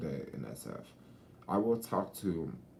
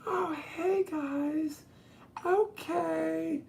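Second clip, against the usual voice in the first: put-on very high falsetto valley girl; mock a bubbly LA girl; squeaky fake-friendly okay; fake-nice LA girl; much slower than usual. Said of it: "they'll still be".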